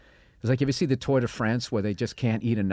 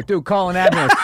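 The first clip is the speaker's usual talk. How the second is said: mock urgent shout; 7 semitones higher, 9 dB louder than usual. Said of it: "they just go".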